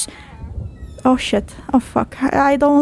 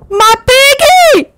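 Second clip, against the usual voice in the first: slow very high and loud squeal; 12 semitones higher, 7 dB louder, slower than usual. Real, not acted. Seeing his piggy, squealing high and loud. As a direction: squeal it with delight.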